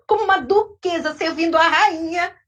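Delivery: ordinary delivery, nothing marked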